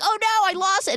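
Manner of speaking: high, loud playful panic; hammed-up cry of loss; mock dismay. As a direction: pretend alarm at dropping something precious. Man pretends to drop blown kiss.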